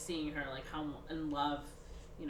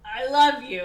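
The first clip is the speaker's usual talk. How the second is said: much louder, higher mock declaration; emphatic declaration; 8 semitones higher, 14 dB louder than usual. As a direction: blurt it out insistently, hammed up. Repeats declaration, having nothing more.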